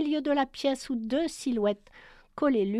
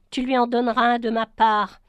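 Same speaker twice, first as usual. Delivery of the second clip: louder, mildly bitter jab; 7 dB louder than usual. Acted. Paola to Tom as she leaves, mildly bitter. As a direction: toss it off with a touch of bitterness. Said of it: "lança-t-elle avec amertume".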